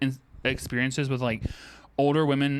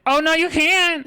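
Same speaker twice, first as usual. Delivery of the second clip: high, loud, slow horrified protest; shocked denial; 13 semitones higher, 8 dB louder, slower than usual. Retold or imitated. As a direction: recoil in disbelief and shout it. Shocked retort to lewd client.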